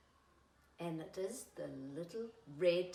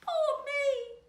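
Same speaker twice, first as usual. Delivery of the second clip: very high, sighing little caboose voice; 6 dB louder than usual. Acted. Caboose sighing back to the flat car, very high. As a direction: sigh it out in a tiny voice. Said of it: "sighed the little red caboose".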